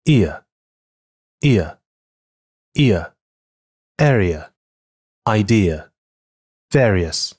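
careful model "ear" gliding e to a; model pronunciation. Shown as a demonstration. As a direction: clear English ear diphthong. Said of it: "它是这么发音的".

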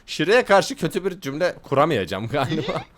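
ordinary talk, nothing marked

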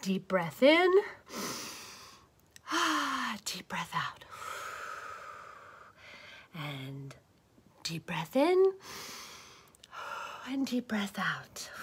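breathing meditation. Acted. Caterpillar whispers breathing instructions to calm down. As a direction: guide your own breath, hushed.

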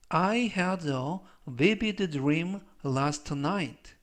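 slow, measured English for learners; slow model reading. Shown as a demonstration. Teacher reading an English example for learners, slow model reading. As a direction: read it out slowly as a pronunciation model.

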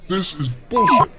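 retold outburst at cop. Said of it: "I tell him to his face".

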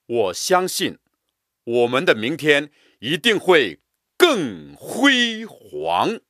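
更辉 煌 stretched with a break before 煌; drawn out, broken stress. Shown as a demonstration. Teacher reciting, stretching and breaking 辉煌. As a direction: hold 辉 long, pause, then 煌. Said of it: "声音拉长了，而且断开".

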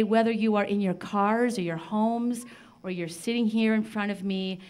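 ordinary talk

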